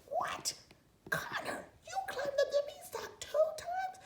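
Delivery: very high squeal of disbelief with a laugh; astonished mom. Acted. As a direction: squeak it out in shock.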